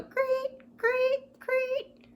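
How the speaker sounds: very high, squeaky cricket chirps. Retold or imitated. Reading to children, a very high cricket chirp. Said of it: "The crickets go".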